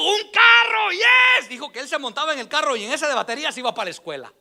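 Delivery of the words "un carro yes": high shouted cheer; mock excited shout. Boy cheering at his toy car, high hammed-up shout. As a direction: yell it like a thrilled kid.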